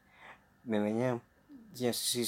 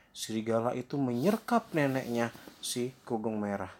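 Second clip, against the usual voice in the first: plain reading voice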